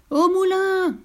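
high, loud happy shout; drops shouting joyfully to Perlette; joyful cry. Acted. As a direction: cheer it out together. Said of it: "crièrent les autres joyeusement".

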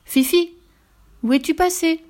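high, anxious calling out; worried call. A narrator's reading. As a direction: call out for a missing friend. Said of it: "cria-t-il inquiet". (appel inquiet)

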